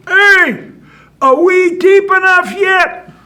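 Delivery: very high, full-voiced holler; drawn-out high yell for attention; far-off shout. Bullfrog shouts from deep grave.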